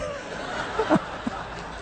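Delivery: a short, unvoiced laugh after the joke; breathy laugh. Real, not acted. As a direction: laugh at your own punchline. After punchline.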